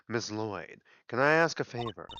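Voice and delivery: usual speaking voice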